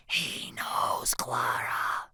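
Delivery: anxious hushed whisper; secretive stage whisper. A narrator's reading. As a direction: whisper it urgently to a classmate. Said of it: "she whispers to me".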